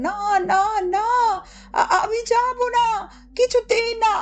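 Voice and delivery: put-on high, frantic child pleading, stammering; a boy's silent cry of refusal, high and panicked; panicked inner cry. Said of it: "তার মন চিৎকার করে বলতে লাগলো".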